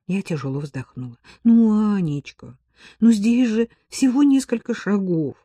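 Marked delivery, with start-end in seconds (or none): 1.4-5.4 higher, wheedling plea; pleading, coaxing. A narrator's reading. Ella coaxes her friend over a short errand, pleading. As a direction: beg a friend sweetly. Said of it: "упрашивала Элла".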